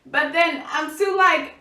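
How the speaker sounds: normal talking voice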